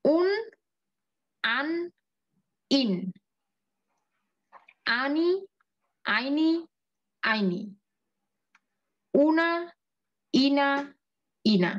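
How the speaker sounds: slow drilled recitation of endings; চাবি recite. Shown as a demonstration. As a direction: recite each ending slowly with a pause. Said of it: "আমরা পুরা ছকটা পড়তেছি". (ধীরে ধীরে আবৃত্তি করে পড়া)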